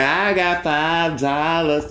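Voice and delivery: slow, drawn-out brag; savoured boast. Retold or imitated. To the girls, reliving the five dollars, slow relish. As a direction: relish every word. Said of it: "I'm like".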